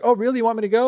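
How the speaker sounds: a spoiled brat balking; bratty daughter. Acted. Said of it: "So the girl's like".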